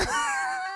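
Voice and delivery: squealing laugh; real laugh; high laugh. Real, not acted. Streamer cracking up at Willie's whine.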